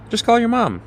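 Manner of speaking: just do it already, press him; insistent urging. Acted. Friend urging him to phone home.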